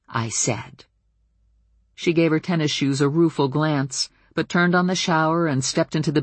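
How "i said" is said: ordinary delivery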